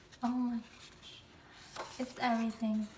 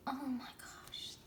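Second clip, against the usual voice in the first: whisper; 8 dB quieter than usual. Real, not acted.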